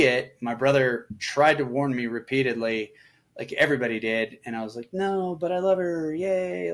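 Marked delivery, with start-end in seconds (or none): 4.9-6.7 naive self mocked. Mocking his naive younger self.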